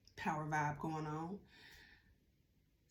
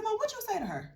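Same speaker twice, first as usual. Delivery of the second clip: indignant high demand; 14 semitones higher, 5 dB louder, faster than usual. Retold or imitated. Confronting mom indignantly.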